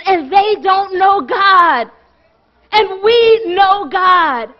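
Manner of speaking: slow it down and drive the point home; preaching at full force. Real, not acted. Preacher pressing the church to share God. Sermon climax on knowing God.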